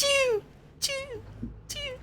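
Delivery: high squeaky echo of a sneeze. Retold or imitated. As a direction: mimic a sneeze fading in echoes. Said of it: "Sneeze just like".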